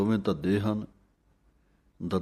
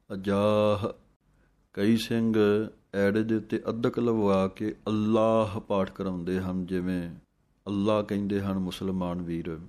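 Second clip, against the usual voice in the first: ordinary delivery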